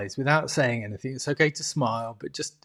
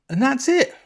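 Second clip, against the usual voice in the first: pitched right up, louder; bright lift, much higher than usual; high sign-off; 11 semitones higher, 4 dB louder than usual. Retold or imitated. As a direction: say it high and final. Retold leader's closing line.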